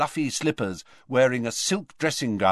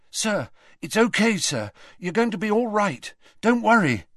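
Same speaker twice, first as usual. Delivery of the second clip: panicked reassurance; 10 semitones higher than usual. Acted. Harry calming collapsed Dumbledore, panicked.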